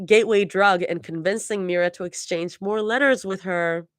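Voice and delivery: plain reading voice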